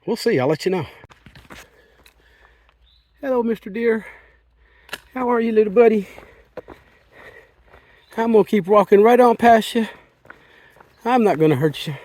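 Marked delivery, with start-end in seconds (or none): 3.2-9.9 talking to an animal. Real, not acted. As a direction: coo gently at a deer. Talking to a deer on trail.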